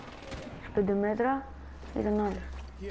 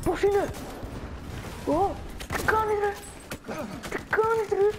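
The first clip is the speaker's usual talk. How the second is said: much higher, cartoonish squeaky voice; squeaky mock greeting; 10 semitones higher than usual. Gamer taunting an enemy soldier in a silly high voice. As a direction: tease the enemy in a silly falsetto.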